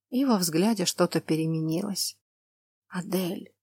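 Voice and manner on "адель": soft, tender whisper of a name. Acted. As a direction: say the name with love. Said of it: "Столько тепла было в этом слове".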